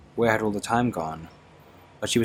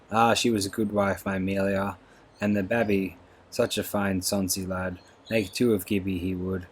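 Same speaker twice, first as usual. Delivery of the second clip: usual speaking voice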